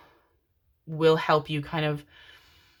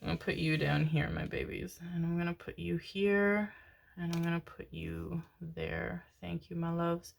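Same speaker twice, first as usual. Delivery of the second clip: much softer, near whisper to the cards; soft murmur; 9 dB quieter than usual. Real, not acted. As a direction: murmur to the cards as you place them. Softly laying out the cards.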